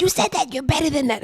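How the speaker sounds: high-pitched mock mother voice; scolding mother. Mother reproaching her daughter, high-pitched mock dismay. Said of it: "My mother said".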